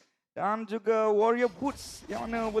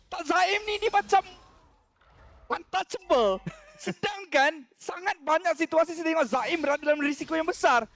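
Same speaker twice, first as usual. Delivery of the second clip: excited; 6 semitones higher than usual. Real, not acted. Caster incredulous about player.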